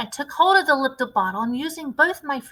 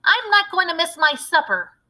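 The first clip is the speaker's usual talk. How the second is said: put-on higher, louder boy voice, stubborn; insist, not missing supper; greedy boy Bruno; 7 semitones higher, 5 dB louder than usual.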